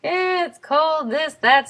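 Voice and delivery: high-pitched chatty small talk; mock small talk. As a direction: imitate chirpy small talk, pitched well up. Mimics small talk about weather.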